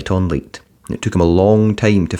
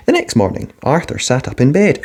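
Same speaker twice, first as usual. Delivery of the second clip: ordinary delivery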